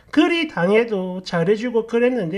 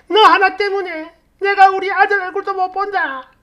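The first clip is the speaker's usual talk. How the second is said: loud, high-pitched accusing rant; mother-in-law making a scene, high and loud; raging tirade; 10 semitones higher, 5 dB louder than usual. Acted. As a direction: rail at the daughter-in-law as a furious mother-in-law. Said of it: "이러면서 난리를 치더라고".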